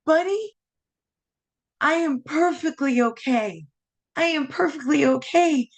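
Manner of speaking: crying but insisting she's fine; tearful reassurance. Real, not acted. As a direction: voice wet with happy tears. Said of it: "these tears".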